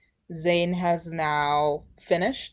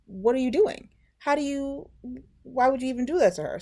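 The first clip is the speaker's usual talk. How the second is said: high-pitched, baffled questioning; appalled disbelief; 8 semitones higher, faster than usual. Retold or imitated. Storyteller challenging what he did to her, appalled. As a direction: demand an explanation, pitched up. Narrator voices outrage at the man.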